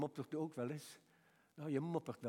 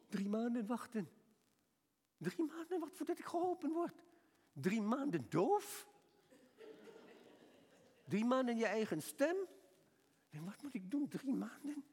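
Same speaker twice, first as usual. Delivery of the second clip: high-pitched, dismayed patient; mock-aghast questioning, pitched way up; incredulous protest; 9 semitones higher than usual. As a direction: protest it in high, acted disbelief. Acting out his dismayed protest to doctor.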